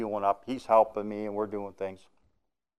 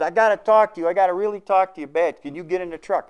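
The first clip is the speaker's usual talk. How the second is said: high, loud, pressing words; urgent plea; 9 semitones higher, 7 dB louder, faster than usual. Retold or imitated. Trucker running over in the rain begging to talk, urgent high plea. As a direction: grab him before he walks away.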